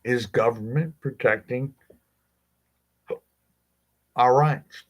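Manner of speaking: hiccuping between words; interrupted by hiccups. Real, not acted. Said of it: "got the hiccups all of a sudden".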